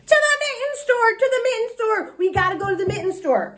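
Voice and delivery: high, fast urgent begging; eager pleading child. A narrator's reading. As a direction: tug at him with the words. Junie pleads with grandpa.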